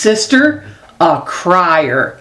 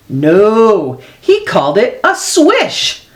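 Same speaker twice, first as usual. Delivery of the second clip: plain reading voice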